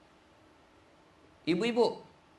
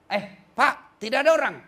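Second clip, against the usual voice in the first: louder, higher call to the visitor at the door; calls out through the door, a bit raised; Fatimah at the door; 6 semitones higher, 6 dB louder than usual. Retold or imitated. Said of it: "Fatimah buka".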